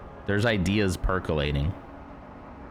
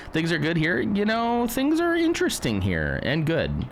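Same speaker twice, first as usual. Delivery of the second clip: much higher, uneasy cheer; high-pitched reassurance; 9 semitones higher than usual. Real, not acted. Pilot reassures viewers amid debris, high-pitched. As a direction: insist all is fine, pitched up. Nervous reassurance while flying.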